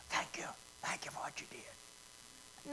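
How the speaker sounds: unvoiced whisper of thanks. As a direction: breathe it secretly into his ear. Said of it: "just whispered in Jesus' ear".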